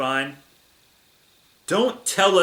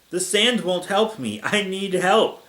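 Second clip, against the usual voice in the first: higher, whiny mock yelling; mild yell; 7 semitones higher, slower than usual. A narrator's reading. Little Godzilla yells about the sand, mock yelling. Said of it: "yells little Godzilla".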